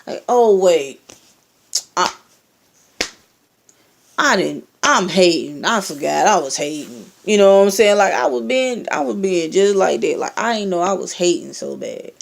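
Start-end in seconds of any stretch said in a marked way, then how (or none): 0.3-7.1 mock-apologetic sarcasm; sarcastic. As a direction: pretend to just realise it, sarcastically. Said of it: "hella sarcastic".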